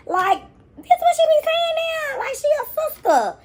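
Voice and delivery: hammed-up teenage sass; mock sassy teen. Mocking girl's new swagger.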